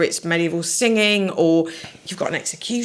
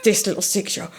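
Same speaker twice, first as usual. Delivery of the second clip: normal talking voice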